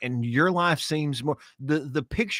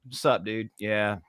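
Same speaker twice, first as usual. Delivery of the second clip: a tired guy who can't be bothered; laid-back dude; 6 semitones lower, 5 dB quieter than usual. Retold or imitated. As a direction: lower and softer, lazy casual greeting. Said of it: "I want somebody to get up and be like".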